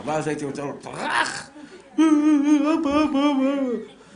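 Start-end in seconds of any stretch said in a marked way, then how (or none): 0.8-1.5 high mock brush-off, sharply tossed out; big brother's scornful shove-off. Big brother swatting away his pesky sibling, mock scornful. 1.9-4.0 put-on high, slow, louder wailing child with a laugh; kid crying for dad. Little brother after a shove, mock wailing for his father.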